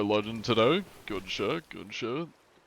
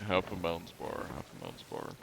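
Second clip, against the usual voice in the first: practising; 4 semitones lower, 8 dB quieter than usual. Shown as a demonstration. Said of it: "I don't think I'm saying it right".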